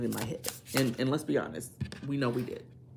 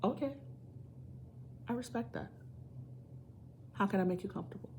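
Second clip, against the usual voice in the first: respectful partner; 5 semitones higher, 8 dB quieter, faster than usual. Pretend. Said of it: "is gonna go".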